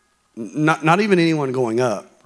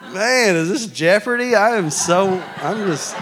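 laughing; 7 semitones higher than usual. Real, not acted. Laughing after discovery.

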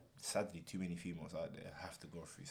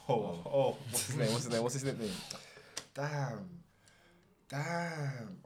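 loud, high, frantic recall; excited, straining to remember; 5 semitones higher, 8 dB louder, slower than usual. Real, not acted. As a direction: shout it out, nearly there.

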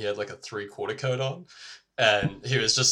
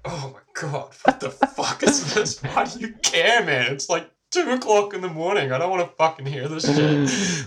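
exasperated; 8 semitones higher, 6 dB louder than usual. Retold or imitated. Acted complaint at unwanted history lecture.

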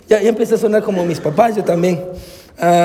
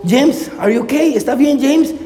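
put-on higher, slowed questioning call to a child; concerned check; 5 semitones higher than usual. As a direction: ask as a worried parent, retold. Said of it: "Me acerco y le digo".

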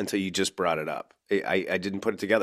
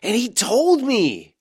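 high, slow, pleading ghost story; whiny insistence like a kid; mock Holden; 8 dB louder, slower than usual. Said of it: "he's running around in this season going".

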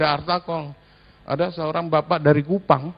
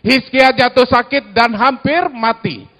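louder, higher solemn Bible reading; scripture reading aloud; 7 semitones higher, 7 dB louder than usual. Acted. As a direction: read the passage together, measured. Congregation reads scripture aloud.

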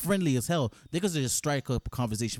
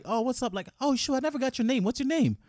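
friendly greeting; 8 semitones higher than usual. Shown as a demonstration. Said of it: "Second time, like".

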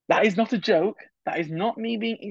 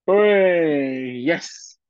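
loud happy cheer then settle; cheer of relief; 4 dB louder, much slower than usual. Real, not acted. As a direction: celebrate finding it at last. Finds the article he searched for.

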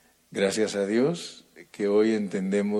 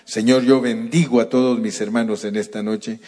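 louder, ardent preaching prayer; fervent prayer; 5 dB louder than usual. Acted. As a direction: pray aloud over the congregation with rising fervor. Pastor leads closing prayer.

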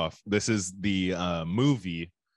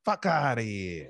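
put-on Italian accent; 4 semitones higher, slower than usual. Answering in mock Italian.

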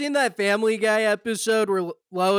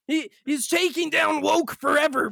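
sarcastic hype; 6 semitones higher than usual. Pretend.

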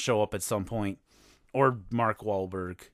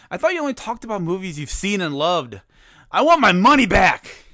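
mock listener complaint; 6 semitones higher, 7 dB louder than usual. Listener complaining to the host, building to a hammed-up yell. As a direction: voice climbs higher and ends in a loud, hammed-up demand. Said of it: "you're asking your iPod or Bluetooth speaker".

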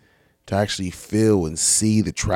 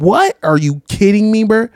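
high, loud what, are you kidding me; loud incredulous outburst, pitched up; shocked disbelief; 11 semitones higher, 8 dB louder than usual. Real, not acted. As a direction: cry out in disbelief. Reacting in disbelief.

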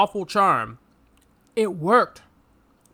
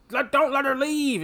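lightly voiced villain command, higher; voicing villain; 7 semitones higher than usual. Villain ordering his men, lightly voiced command. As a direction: bark an order to henchmen.

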